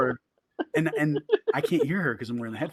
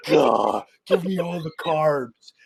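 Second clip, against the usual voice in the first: slow, grabby carb demand; greedy craving; 2 semitones higher, 7 dB louder, much slower than usual. Retold or imitated. Shopper at the shelf greedily demanding carbs, slow. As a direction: growl it out like a starving beast. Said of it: "so I'm like".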